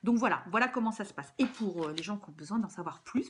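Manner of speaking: ordinary talk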